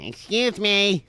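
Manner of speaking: high, drawn-out mock lady voice; hammed-up "excuse me" of an interrupting woman; mock prim woman. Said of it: "a woman who starts screeching".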